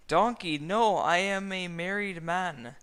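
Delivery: loud, high refusal; shouted high no; loud protest. A narrator's reading. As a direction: push him away loudly. Shrek protesting again.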